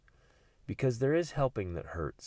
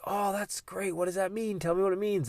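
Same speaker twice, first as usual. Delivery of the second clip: upbeat dad; 11 semitones higher than usual. Retold or imitated. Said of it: "And I'm like".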